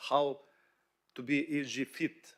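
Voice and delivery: ordinary talk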